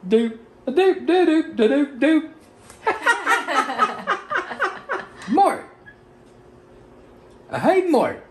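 high squeaky jingle shout; goofy high voice. Acted. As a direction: yell it high and cartoonish. Recording jingle rapper vocals.

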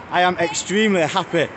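ordinary delivery

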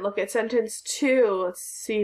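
normal talking voice

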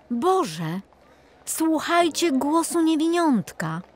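put-on a father amazed at his child; moved father. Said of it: "powiedział wtedy jego ojciec". (wzruszony ojciec, dużo wyżej)

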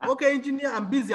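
a hint of a boss excusing himself; imitated managing director. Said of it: "It was the M D".

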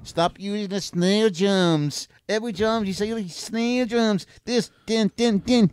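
very high, whiny put-on voice; mocking drummer impression. Griping about snare drums, high mocking voice. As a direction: squeal it high, imitating a repetitive snare drum. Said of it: "is that an impression of [name]".

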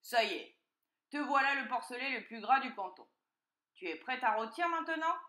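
loud, emphatic announcement; triumphant fox. A narrator's reading. Fox to freshly washed piglet, loud and commanding. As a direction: announce it firmly, then command.

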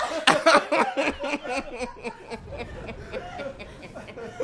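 long high wheezing laugh; gasping giggling fit; helpless laughter. Real, not acted. Choking with laughter on gum.

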